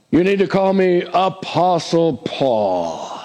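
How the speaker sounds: "Apostle Paul" drawn out slowly and grandly; slow, puffed-up title announcement; mock self-important emphasis. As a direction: pompously stretch out "Apostle Paul". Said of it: "put emphasis on that word".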